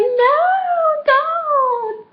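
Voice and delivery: high, loud drawn-out squeal of protest; playful protest. Retold or imitated. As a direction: squeal it out high, stretching the words. Acted refusal as jacket is pulled off.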